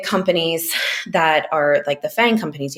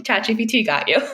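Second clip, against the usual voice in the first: laughing; 8 semitones higher than usual. Real, not acted. Joking about ChatGPT helping.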